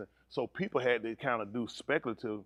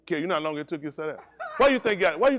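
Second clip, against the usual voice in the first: laughing tease; 6 semitones higher, 7 dB louder, much faster than usual. Real, not acted. Teasing Kerry, laughing.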